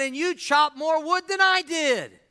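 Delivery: high, loud indignant protest; mad, incredulous. Acted. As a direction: act out an outraged sore loser. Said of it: "And he was so mad".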